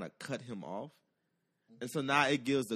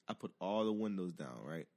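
normal talking voice

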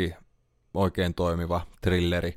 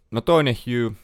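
usual speaking voice

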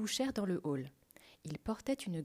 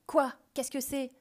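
higher-pitched irritable bark; 5 semitones higher, 6 dB louder than usual. A narrator's reading. Commissioner answering the phone, barking. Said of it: "le commissaire aboya".

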